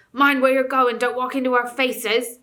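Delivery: loud, grumbling surly dwarf. A narrator's reading. Dwarf scolding visitors for walking into faces, surly and loud. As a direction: bark at the clumsy intruders. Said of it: "in a surly voice".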